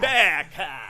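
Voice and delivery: loud drawn-out Australian "beer can"; mock Australian. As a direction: stretch the words like an Aussie. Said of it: "slow and deliberate".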